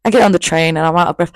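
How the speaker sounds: ordinary delivery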